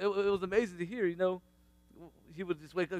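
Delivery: usual speaking voice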